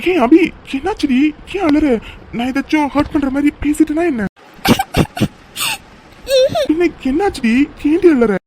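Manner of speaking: startled, rushing to ask what's wrong; alarmed concern. Acted. Alarmed as she suddenly cries. (பதற்றமான கவலை)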